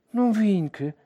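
hohe, etwas lautere Frauenstimme; Mutter zum Kind. A narrator's reading. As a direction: das Kind mütterlich hoch ansprechen. Mutter spricht das Kind an. (mütterliche Anrede)

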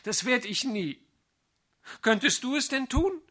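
put-on high, pleading young girl; girl's dismayed reply. A narrator's reading. Vrenchen protesting to Sali she won't go without him, high and pleading. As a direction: Vrenchen aghast at being sent away.

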